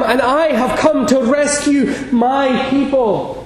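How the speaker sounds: rousing shouted climax; proclaiming shout. Retold or imitated. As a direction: shout it as the preacher's peak. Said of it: "He was saying".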